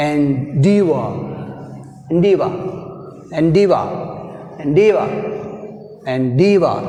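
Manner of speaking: "endeavor" with weight on "deav"; stress on second syllable. Shown as a demonstration. Teacher drilling another example, second syllable stressed. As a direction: punch "deaver" in "endeavor", take after take. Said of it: "we have stress on deaver".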